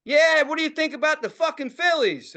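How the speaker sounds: high, loud, brash Philly shop owner; loud store owner. Retold or imitated. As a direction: imitate the owner hollering over sports. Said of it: "the owner's talking like this".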